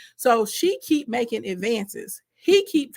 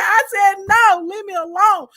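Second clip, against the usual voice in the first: louder, higher, slowed-down no; firm loud refusal; 8 semitones higher, 6 dB louder than usual. Retold or imitated. Joseph shouting down Potiphar's wife. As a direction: put your foot down.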